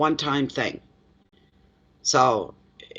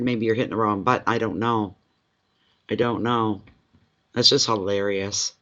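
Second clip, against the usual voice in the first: usual speaking voice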